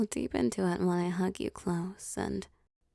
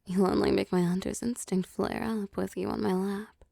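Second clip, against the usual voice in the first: usual speaking voice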